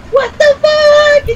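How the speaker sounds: high, loud, drawn-out mock scream; retold scream. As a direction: imitate a friend screaming in shock, very slowly stretched. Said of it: "she's, like, screaming".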